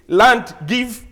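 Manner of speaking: slow, loud, halting pidgin phrases; mock an Oyibo trader's broken English; spoken word by word, half laughing; colonial broken English. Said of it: "dem try to also do broken English".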